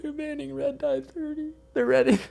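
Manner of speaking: high wailing sob, words cracking; crying. Real, not acted. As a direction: cry it out in real distress. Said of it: "and you're fucking crying".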